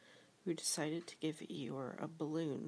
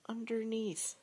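small, high, louder voice; underneath sort of way; 7 semitones higher, 5 dB louder than usual. A narrator's reading. Piglet answering from underneath, high little voice. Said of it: "in an underneath sort of way".

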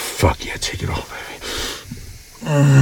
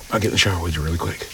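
ordinary talk, nothing marked

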